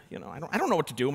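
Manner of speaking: ordinary talk, nothing marked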